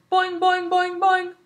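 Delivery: high bouncy boing sound; boing like a jumping frog; excited springy boing; loud boing. Acted. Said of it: "Og splashed loudly".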